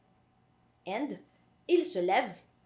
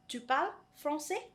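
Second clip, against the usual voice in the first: "Tu parles français" asked higher, with a rise on "français"; "Tu parles français" raised and left hanging as a question; question intonation; 6 semitones higher than usual. Shown as a demonstration. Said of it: "So that's already a question".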